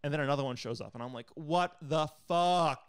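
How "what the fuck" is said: slow, high, louder exasperated cry; drawn-out disbelief. Retold or imitated. Another centipede appears, slow exasperated cry. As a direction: stretch it out in disbelief.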